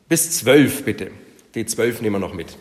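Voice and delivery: normal talking voice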